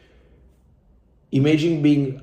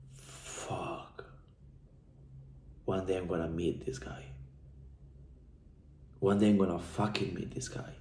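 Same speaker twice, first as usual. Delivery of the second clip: a near-whisper, much softer, as if to himself; hushed inner vow; 4 semitones lower, 14 dB quieter than usual. Retold or imitated. Recalling his private promise to meet John, whispered. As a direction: breathe the vow out barely voiced.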